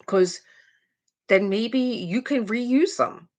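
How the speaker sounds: usual speaking voice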